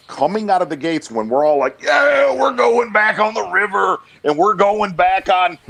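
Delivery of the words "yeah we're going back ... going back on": loud, high hyped-up shout, imitating eager boaters; mock excited boater. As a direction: play the giddy fisherman yelling about the lake.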